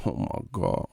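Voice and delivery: put-on low Southern boxer voice; Deontay Wilder impression. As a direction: imitate the boxer's Southern English, dropped low and quiet. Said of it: "gonna have Wilder English".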